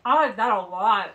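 talking while eating crisps; muffled speech through chewing; mouth full, chewing. Real, not acted. Seen 1: Speaker unclear; woman chews behind hand, man bites crisp then.

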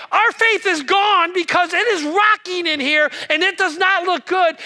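scared fishermen protesting to Jesus; panicked disciples. Retold or imitated. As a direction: shout it over the rocking boat. Disciples panicking in rocking boat.

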